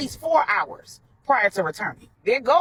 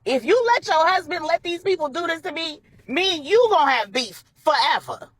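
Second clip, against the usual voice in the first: moderately raised, high threatening yell; a pitched-up warning, only partly yelled; 6 semitones higher than usual. A narrator's reading. Portia from under Donovan to Maribel, threatening half-yell. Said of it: "Portia yelled from under Donovan".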